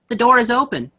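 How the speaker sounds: put-on sing-song like a ghost child copying the nanny; little girl voice. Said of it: "you hear a little girl".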